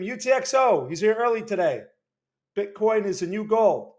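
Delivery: usual speaking voice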